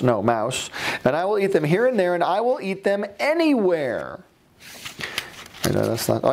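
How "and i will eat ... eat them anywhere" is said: high, bouncing rhyme read aloud; the grumpy old man raving about green eggs; gleeful storybook character. Acted. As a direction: ham up the joy of the new convert. Reading Seuss rhyme in character.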